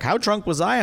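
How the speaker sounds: high-pitched mimicked question; imitated woman. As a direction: lightly voice her, pitched well up. Retold reply of a crying woman.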